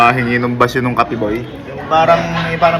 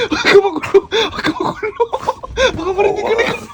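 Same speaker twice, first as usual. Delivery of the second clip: giggling. Real, not acted. Hiding, giggling to companion.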